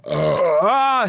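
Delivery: burp slipping out mid-word. Real, not acted. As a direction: let a loud burp break the word. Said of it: "I just had to burp that out".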